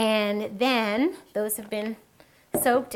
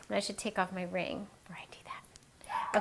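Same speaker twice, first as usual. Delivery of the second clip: muttered aside; 2 semitones lower, 10 dB quieter than usual. Real, not acted. Cook remembering to take off a ring, muttered aside. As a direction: say it under your breath, offhand. Aside to self during demonstration.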